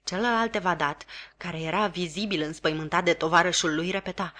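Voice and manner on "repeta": usual speaking voice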